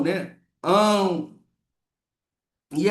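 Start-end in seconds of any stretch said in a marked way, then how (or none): 0.6-1.4 "Ão" sounded nasal and full. Shown as a demonstration. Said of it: "o som do ão".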